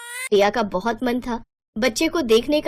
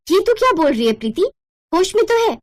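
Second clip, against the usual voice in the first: much higher, louder stunned cry; shocked outrage; 8 semitones higher, 3 dB louder, faster than usual. Acted. Elder sister shocked at the demand, crying out in disbelief. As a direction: react in disbelief.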